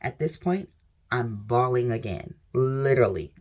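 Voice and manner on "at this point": usual speaking voice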